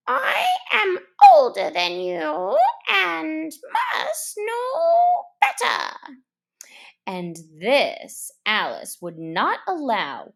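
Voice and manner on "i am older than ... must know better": high, sulky bird voice with a laugh; sulky Lory. Acted. Lory sulking at Alice, high and huffy. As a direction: pout it out like a sulky parrot. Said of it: "turned sulky and would only say".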